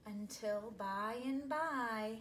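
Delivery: slow, suspenseful storybook pacing; drawn-out anticipatory read; slow build. A narrator's reading.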